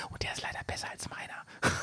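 whispered aside; whispering. Real, not acted. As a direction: drop to a whisper for a confession. Whispered aside about the stove.

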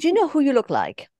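put-on lightly English-accented question. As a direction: ask it with a British accent. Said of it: "hörst du den englischen Akzent?".

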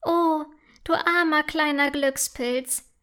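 pitying "oh", then tender; sympathetic. A narrator's reading. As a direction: compassionate little elf. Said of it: "sagt Milea mitfühlend".

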